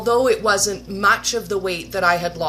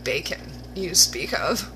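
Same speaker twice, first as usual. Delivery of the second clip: lower, softer, seductive purr; flirty sultry; 3 semitones lower, 3 dB quieter than usual. Pretend. Swooning over bacon on the video, sultry flirt. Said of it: "And I was like".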